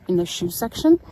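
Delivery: mock snarky, questioning lilt at the end. Employee answering a customer seeking water shoes, mock snarky. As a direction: imitate a condescending employee, ending in a question. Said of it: "That's how he said it".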